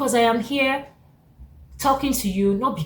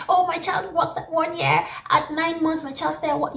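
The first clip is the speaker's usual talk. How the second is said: proud parent gushing; 7 semitones higher than usual. Pretend.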